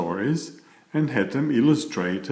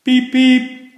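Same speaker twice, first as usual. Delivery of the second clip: whistle toot; 14 semitones higher, 9 dB louder than usual. Acted. Train whistle at story end.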